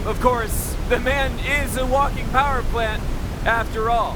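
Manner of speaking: high, loud showman announcement; announcer voice. Acted. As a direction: present it like a fair barker. Presenter introduces the generator.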